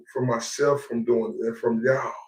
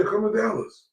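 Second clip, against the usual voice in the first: taunting invite; 6 semitones higher, 4 dB quieter than usual. Real, not acted. Challenging a rival.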